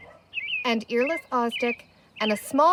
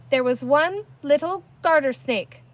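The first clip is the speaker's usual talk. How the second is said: louder ringing announcement; cried out; 4 semitones higher, 6 dB louder than usual. A narrator's reading. Girl tells villagers the truth, loud cry. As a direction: call it out to the crowd. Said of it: "cried Penny".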